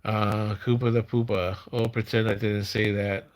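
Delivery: usual speaking voice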